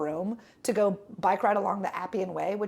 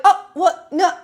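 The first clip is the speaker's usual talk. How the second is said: shocked protest; 8 semitones higher, 10 dB louder, slower than usual. Retold or imitated. Bus doors shut on her.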